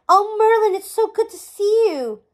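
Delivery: high, loud overjoyed greeting; bursting with joy at the reunion; joyful. A narrator's reading. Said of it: "burst with joy".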